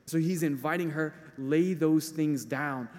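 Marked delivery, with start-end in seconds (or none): 1.4-2.9 Jesus voiced very slowly; slow and deliberate, laying it down; slow gentle invitation. Jesus inviting the woman to lay things down, slow.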